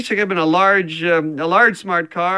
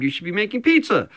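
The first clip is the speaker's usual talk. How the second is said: usual speaking voice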